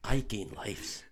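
soft put-on Scottish accent; Oliver Wood. Quidditch keeper teaching Harry, hushed Scottish accent. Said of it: "for me to do a Scottish accent".

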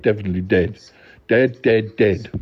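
normal talking voice

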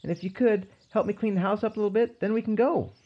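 high-pitched, quick character voice; voiced request. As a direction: lift it well above your own voice, hurried. Quoted request at story's opening.

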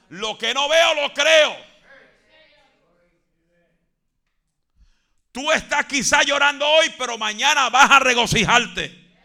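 shouted, high fiery preaching; roaring revival preacher; full-force preaching. Acted. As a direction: preach it at the top of your lungs.

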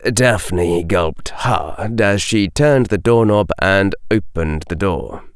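ordinary delivery, nothing marked

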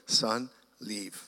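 gentle fatherly order to go; a hint of the Lord's voice. Voicing the Lord.

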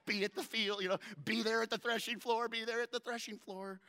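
high loud hurried call; calling out while running. Retold or imitated. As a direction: shout directions on the run.

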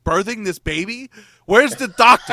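retold panic, building to a high shout; comic delivery-room panic, rising sharply; panicked exclamation. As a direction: call out loud and high in mock alarm.

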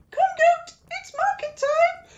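high squeak, straining hard; squeaky strain. Acted. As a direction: squeak it out as you pull. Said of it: "Loki squeaked at the strain".

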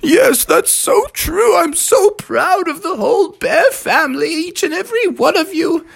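high-pitched, gushing mama bear; Mother Bear. Acted. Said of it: "Mother Bear was like".

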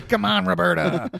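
high, loud playful protest; hammed-up groan of complaint; mock exasperated. Mock complaint at his mother.

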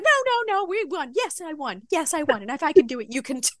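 very high "no, no" settling down; overwhelmed winner crying with joy; tearful victory babble. Retold or imitated. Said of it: "and I was like".

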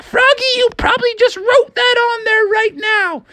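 very high, accusing mom voice; scolding mother. A narrator's reading. Mom doubting her son's story about the gold, scolding high.

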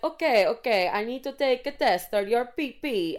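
high, sing-song mock Italian; botched Italian accent, drifting toward Borat. Italian pharmacist asking for a urine test, in a mock Italian accent. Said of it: "trying to do an Italian accent".

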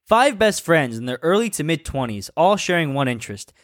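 reading a fanfic aloud; story narration. Acted.

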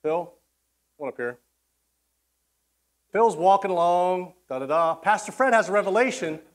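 ordinary talk

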